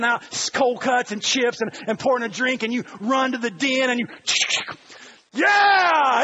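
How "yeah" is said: loud, high, drawn-out yell; football fan's cheer. Acted. Fan in the den watching football, loud excited yell. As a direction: erupt as the game comes on.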